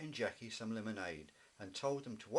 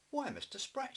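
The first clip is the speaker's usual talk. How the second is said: much higher, startled greeting; surprised; 4 dB louder than usual. Acted. Mummy Bear finds the fishmonger at her door, surprised and high. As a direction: caught off guard at the door.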